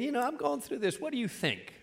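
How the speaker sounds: hushed mock confiding at the bar; soft, lightly acted drinker's murmur; tipsy bar patron. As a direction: play a juiced-up guy softly seeking advice. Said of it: "a little juiced up".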